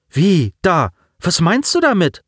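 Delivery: put-on high, puzzled girl's question; baffled, slightly irked query; irritated confusion. A narrator's reading. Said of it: "fragte Daya irritiert".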